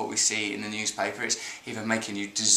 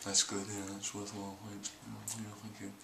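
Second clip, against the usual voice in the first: fading murmur; 5 semitones lower, 15 dB quieter than usual. Pretend. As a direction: murmur softer and softer until barely there. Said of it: "I'll go".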